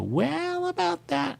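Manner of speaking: high squeaky mock voice; mock voice of them. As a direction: squeak it with a hesitant dodge. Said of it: "they go".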